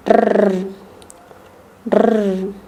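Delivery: purring trilled rrr; long tongue trill like a cat's purr; rolled r. Shown as a demonstration. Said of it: "Try making a purring sound like a cat".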